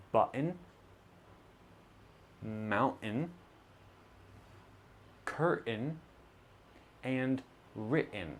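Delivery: button, mountain, curtain, written with a throat stop before the N; glottal T before N. Shown as a demonstration. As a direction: stop in the throat, then a nasal N. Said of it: "words such as".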